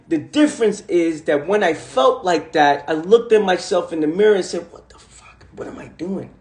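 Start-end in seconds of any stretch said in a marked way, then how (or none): 4.7-6.3 hushed whisper to himself. Retold or imitated. Whispering to himself in the mirror, stunned. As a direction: breathe it out, barely voiced, stunned.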